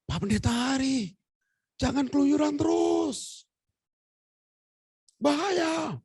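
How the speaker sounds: high-pitched drawn-out warning cry; slow, high exclamations of alarm; mock admonishing call. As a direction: imitate friends scolding in a high, stretched voice. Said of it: "Sampai beberapa teman nasihatin".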